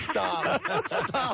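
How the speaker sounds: high, laughing mock plea to stop; mock pleading. Bandmate mocking a beaten wrestler in makeup, high laughing plea. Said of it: "seeing somebody in his makeup on the mat saying".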